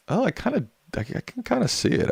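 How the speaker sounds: very high mock falsetto reaction; squeaky high voice. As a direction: squeak it out in playful surprise. Reacting to Al Gore lookalike.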